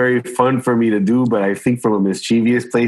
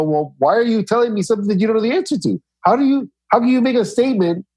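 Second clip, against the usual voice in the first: mock innocent questioning; 9 semitones higher than usual. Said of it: "I go".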